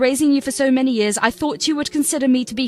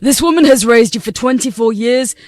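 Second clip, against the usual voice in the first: loud, furious scolding of his daughter; furious father; 6 dB louder than usual. Acted. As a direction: tear into her for the insult. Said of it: "He was furious at Lizzie".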